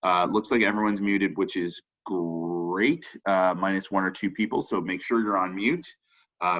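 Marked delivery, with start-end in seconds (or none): 2.0-3.0 drawn-out cartoon mascot "great"; Tony the Tiger. Real, not acted. Host tells the muted group all is great, Tony the Tiger style. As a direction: stretch the word out with a growly rolled r. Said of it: "great Tony the Tiger impression".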